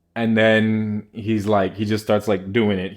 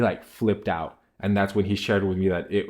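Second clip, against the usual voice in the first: ordinary talk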